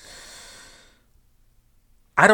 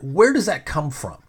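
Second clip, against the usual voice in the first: ordinary talk, nothing marked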